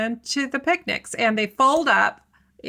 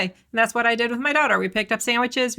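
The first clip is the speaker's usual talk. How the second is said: normal talking voice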